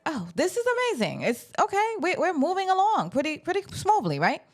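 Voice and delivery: fake-bright, clearly sarcastic; sarcastic delight. Vlogger cheering the wife's divorce, high mock sing-song.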